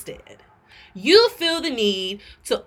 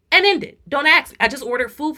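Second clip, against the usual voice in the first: higher and louder; heated outburst; 5 semitones higher, 4 dB louder, faster than usual. Real, not acted. Telling the cashier off, higher and louder. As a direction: snap it, done arguing.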